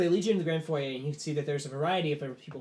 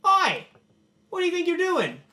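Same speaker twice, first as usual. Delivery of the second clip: very high, loud heckle; loud, high-pitched outraged guest; indignant shout; 10 dB louder than usual. Acted. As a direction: yell a protest across the room. Upper-crust guest calls out in protest.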